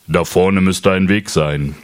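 low, slow kangaroo voice; Känguru-Stimme. Acted. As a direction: speak low and unhurried as the kangaroo. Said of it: "sagt das Känguru". (tiefe, langsame Känguru-Stimme)

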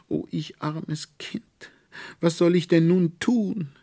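sobbing. Acted. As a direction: plead through tears like a child.

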